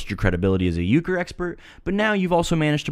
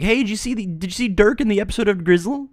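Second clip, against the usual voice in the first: high mock-confused voice, much higher pitch; confused grandson quote; 10 semitones higher than usual. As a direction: ham up a muddled kid telling grandma.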